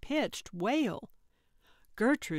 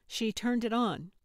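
ordinary delivery

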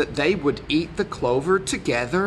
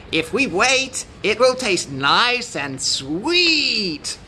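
goat character; 5 semitones higher than usual. A narrator's reading. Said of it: "said the goat".

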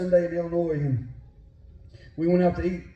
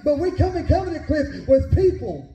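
high, urgent sermon delivery; full-force preaching; 11 semitones higher, 3 dB louder than usual. Real, not acted. Preacher urging the church to regain its vision, full-force preaching. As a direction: preach it with rising fervor, pitched well above normal talk.

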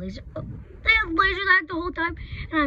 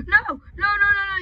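panicked cry; 6 semitones higher, 7 dB louder than usual. Real, not acted. Being caught by the animatronic.

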